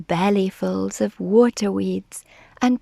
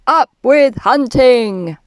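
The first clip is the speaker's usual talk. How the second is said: loud tyrannosaur war chant; loud chant at ordinary pitch; shouting; 2 semitones higher, 7 dB louder than usual. A narrator's reading. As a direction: shout it as a rallying cry. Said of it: "And they shouted".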